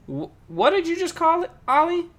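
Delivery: high, loud disbelieving question; acted incredulous dad. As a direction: stammer into a shocked parental question. Dad confronts kid in retold story.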